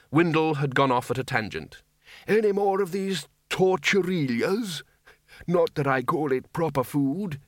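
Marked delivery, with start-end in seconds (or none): 2.3-7.4 put-on high-pitched querulous old wizard; rambling old man. A narrator's reading. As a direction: pipe up as an old codger going off at a tangent. Voices old Windle rambling.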